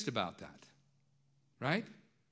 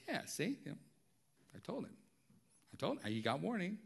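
self-justifying; 5 semitones higher, 6 dB quieter, faster than usual. Retold or imitated. Self-justifying dad retold to congregation.

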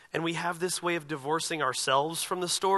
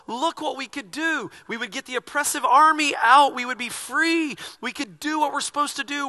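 higher, hopeful disciples dreaming of power; eager disciples; 8 semitones higher, 6 dB louder than usual. Retold or imitated. Pastor voicing the disciples imagining freedom from Rome, higher and eager. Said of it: "they're probably thinking good".

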